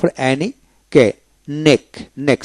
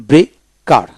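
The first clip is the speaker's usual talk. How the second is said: Breaker split as bre, pause, kar; syllable by syllable; much slower than usual. Shown as a demonstration. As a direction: break breaker in two for learners. Teacher breaks word, then joins it.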